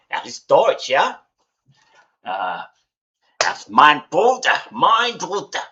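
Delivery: lightly put-on German accent. Imagining how [name] talks, in a put-on German accent. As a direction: slip into playful, punchy German. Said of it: "[name] also has quite the German accent".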